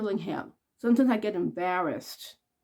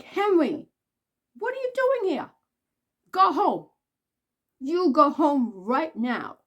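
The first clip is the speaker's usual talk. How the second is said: calling a dog home; 6 semitones higher, 3 dB louder than usual. Retold or imitated. Scolding the neighbor's dog Henry, high and loud.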